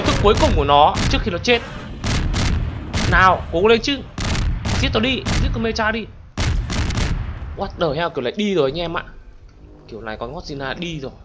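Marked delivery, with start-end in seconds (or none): none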